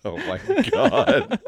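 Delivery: high-pitched, drawn-out laughing exclamation; laughing hard. Real, not acted. Co-host reacting to a terrible accent, laughing hard. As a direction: crack up laughing through the words.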